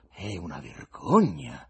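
slow, low, loud pronouncement; weighty, slow condemnation; sententious verdict. A narrator's reading. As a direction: pass judgement heavily, dragging the words. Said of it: "sentenziò l'ospite".

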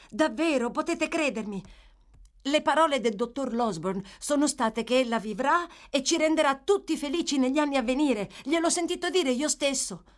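high, earnest, excited assurance; eager insistence. Acted. Boy repeating the doctor's promise, eager. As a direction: convince him with eager conviction.

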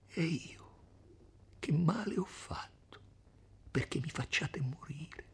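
sussurro spaventato e implorante; supplica sussurrata. Acted. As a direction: sussurra la domanda disperata. Lucia implora in ginocchio. (sussurro supplichevole e impaurito)

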